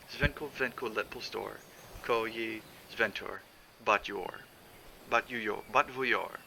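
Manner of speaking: Zvenko, zvenko recited slowly in the island dialect, sing-song rhythm; San Lorenzo dialect. Shown as a demonstration. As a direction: read the dialect rhyme with its strange sounds, slowly. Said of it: "the same poem went like this".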